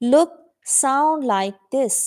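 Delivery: drawn-out, careful phrasing; slow model reading. Shown as a demonstration. Teacher models the sentence slowly for the class. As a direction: say each word slowly to be copied. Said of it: "ایسی آواز نکالو".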